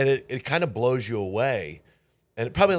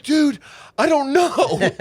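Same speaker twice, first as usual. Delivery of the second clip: much higher, slow, laughing confession; laughing helplessly; 8 dB louder, slower than usual. Retold or imitated. Answering his manager, laughing helplessly. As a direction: giggle it out, embarrassed. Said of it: "I'm like".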